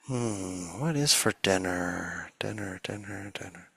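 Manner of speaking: musing softly, fading to almost nothing; mind drifting off. Acted. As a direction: let the repeated word trail away like a stray thought. Acts out drifting into distraction.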